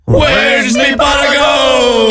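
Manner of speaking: high, slow stage-Irish yell; hammed-up Irish accent, drawn out; mock Irish shout. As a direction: belt it like a greedy leprechaun. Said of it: "you have to say in an Irish accent".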